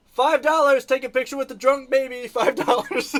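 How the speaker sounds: high, loud mock sales pitch; dad hawking photos with his sleeping baby, playful shout; yelling out. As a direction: bark it down the street like a hawker. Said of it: "I was yelling out".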